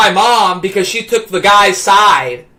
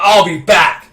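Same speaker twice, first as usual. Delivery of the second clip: Terminator; 3 semitones lower than usual. Retold or imitated. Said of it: "like he's like the Terminator or something".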